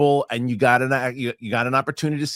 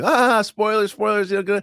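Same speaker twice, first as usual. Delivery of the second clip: playful; 9 semitones higher than usual. Retold or imitated. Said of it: "I was super playful".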